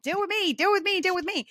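high-pitched, insistent cry for attention; mock screaming. An unmet need demanding attention, mock screaming. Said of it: "screaming at you going".

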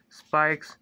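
ordinary delivery

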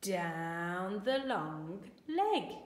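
slow instruction. Shown as a demonstration. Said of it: "We're going to have a go at writing oo".

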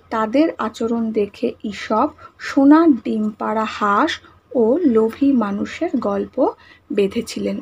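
slow, word by word for the class to write; slow dictation. Shown as a demonstration. Teacher dictating the answer to pupils, slowly. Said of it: "তাহলে আমরা কি লিখব?".

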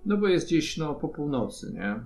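ordinary talk

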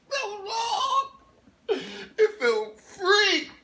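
hammed-up sobbing cry of relief; wailing release. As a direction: let it burst out in a wail. Tears finally released.

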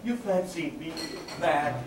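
usual speaking voice

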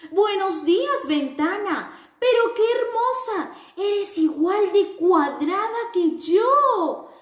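high, bright-eyed little square voice; sing-song greeting for children; cheerful square character. Acted. The square happily greets its friends in a high cheerful voice.

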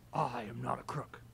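a hint of Nixon's denial; lightly done Nixon voice; Nixon impression. Boy answers Rachel with a light Nixon impression.

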